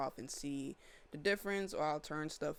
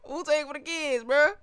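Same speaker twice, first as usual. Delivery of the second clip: high squealing laugh; 12 semitones higher, 12 dB louder than usual. Real, not acted. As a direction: crack up at your own joke. Joke then laughter.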